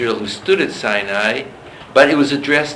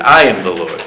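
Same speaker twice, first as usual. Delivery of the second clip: "I" pushed louder; stress on I; 2 semitones lower, 6 dB louder than usual. Shown as a demonstration. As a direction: open by landing hard on "I". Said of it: "the emphasis now is on that first word".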